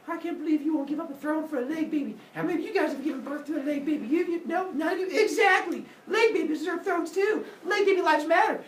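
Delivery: high, indignant mock rant; throwing a fit. Zeus accusing other gods of selfishness, throwing a fit. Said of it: "So he starts throwing a fit".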